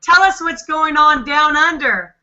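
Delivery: usual speaking voice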